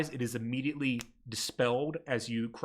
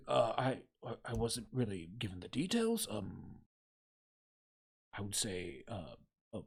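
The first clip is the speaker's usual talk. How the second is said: softer, halting, hesitant mumble; nervous applicant; 6 semitones lower, 9 dB quieter than usual. Acted. Timid applicant stammers at the clerk's desk. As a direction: trail off, lose your nerve.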